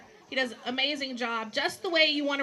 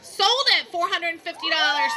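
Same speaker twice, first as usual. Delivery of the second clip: shouting; 4 semitones higher, 8 dB louder than usual. Real, not acted. Auctioneer closes the sale.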